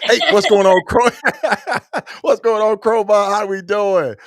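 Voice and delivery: high, loud, laughing hello; excited greeting. Real, not acted. Host greeting viewers by name, loud and excited. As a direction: hype up the chat. Hyped shoutouts to live viewers.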